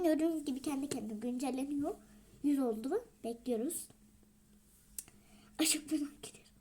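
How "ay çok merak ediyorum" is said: whispered, bursting with curiosity; excited whisper. Real, not acted. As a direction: breathe it out in a thrilled whisper.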